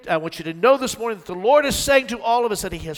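loud, high fervent preaching; preaching at full force. Acted. Preacher pressing the point home to the church, loud and fervent. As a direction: build to the climax with fire.

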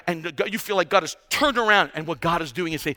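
ordinary talk, nothing marked

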